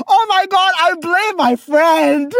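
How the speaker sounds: high, squealing through laughter; real laughing fit, words stretched high; helpless laughter. Real, not acted. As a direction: gasp it out while cracking up. Cracking up at her slip.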